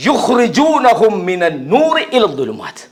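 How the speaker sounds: slow, measured Arabic verse recitation; Quran recitation. Acted. Preacher answers his own question with scripture, slowly recited. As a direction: recite it with lengthened vowels. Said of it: "அந்த தாகூத்துகள் என்ன செய்கிறார்கள்?".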